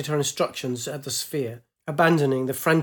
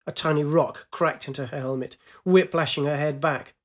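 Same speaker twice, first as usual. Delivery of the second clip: ordinary delivery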